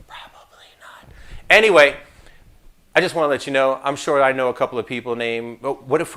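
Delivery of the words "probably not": a sly whispered aside, with no voice at all. Shown as a demonstration. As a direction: drop to a whisper as if telling a secret.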